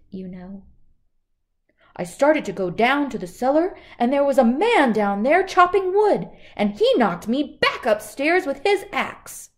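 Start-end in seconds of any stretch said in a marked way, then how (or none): 2.0-9.5 higher, louder, rushed panic; frightened robber. Acted. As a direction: tell it in a breathless fright. Frightened robber tells story.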